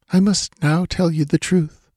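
high, composed and stately; regal woman voice. A narrator's reading. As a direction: draw yourself up like a queen.

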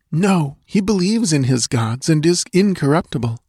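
high, anxious female character voice; frightened dancing girl. A narrator's reading. Captive girl telling Conan the secret way, anxious. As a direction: explain earnestly in a high girlish voice.

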